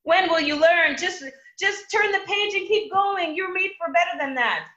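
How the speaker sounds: high, loud, pleading voice of God from above; God exasperated, urging people to move on; voicing God. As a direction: call down like a patient heavenly father. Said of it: "God must be sitting from up above like".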